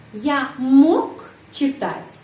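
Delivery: мог held long and falling, slow; stress on мог. Shown as a demonstration. Teacher showing students where the stress falls on мог. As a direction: lean on мог slowly. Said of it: "Penekanan intonasi berada pada kata мог".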